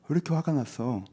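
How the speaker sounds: ordinary delivery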